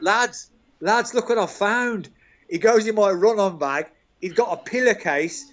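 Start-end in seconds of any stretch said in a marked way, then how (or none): 0.0-2.1 higher, a touch of gleeful calling out; rally the lads to see the prank; mock announcement. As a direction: teammate gleefully calling the dressing room over. Teammate calling the squad over.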